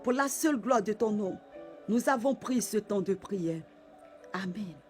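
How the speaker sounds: closing a prayer in hushed adoration; tender whispered love. Real, not acted. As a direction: murmur your love to God, intimate and hushed. Intimate closing of worship prayer.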